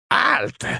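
very high-pitched cry of halt; shouted cry. A narrator's reading. Fallen woman calling the departing narrator back, crying out. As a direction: cry out sharply, far up in pitch. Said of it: "cria-t-elle".

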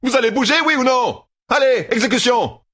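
crié très haut et fort; cri. A narrator's reading. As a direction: hurler l'alerte. Matt shouts at guard. (Matt crie l'alerte à la garde)

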